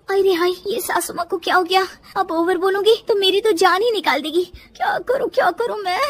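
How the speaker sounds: high, fretful wail; whimpering panic; panicking daughter-in-law. Acted. Daughter-in-law panics over frozen mother-in-law.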